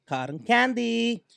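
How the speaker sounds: high, slow drawled cotton candy; frat bro. Pretend. Friends tasting, mock English drawl.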